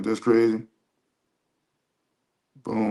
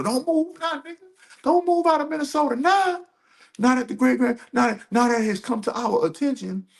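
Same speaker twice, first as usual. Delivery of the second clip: very high-pitched, put-on protest; mocking taunt; 13 semitones higher than usual. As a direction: shoot the voice up high to taunt them. Taunts millionaires fleeing the state.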